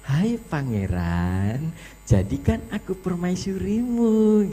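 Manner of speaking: drawn-out coquettish plea, climbing high; mock coy. Judge mimics plea to the prince, exaggeratedly coy. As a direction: play a flirty would-be queen, stretching the words. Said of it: "marah malah kayak centil".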